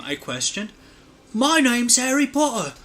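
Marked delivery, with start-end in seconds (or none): 1.3-2.7 higher, put-on English accent; mock English accent. A narrator's reading. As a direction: play him with a hammed-up English accent. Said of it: "pretending to have an English accent".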